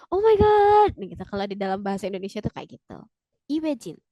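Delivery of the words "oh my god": loud, high cry of amazement; hammed-up astonishment; disbelief. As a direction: exclaim as if stunned by news. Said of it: "Gak nyangka gitu ya".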